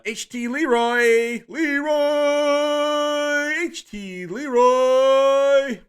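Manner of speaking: very high, loud, slow drawn-out call of the name; ring announcer. Acted. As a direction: stretch "Leroy" like a ring announcer. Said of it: "drawn-out announcer call".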